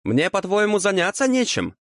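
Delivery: high-pitched affronted question; outraged devil's rhetorical question; indignant. A narrator's reading. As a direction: ask it high, as if insulted. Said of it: "возмутился чёрт".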